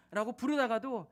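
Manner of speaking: ordinary talk, nothing marked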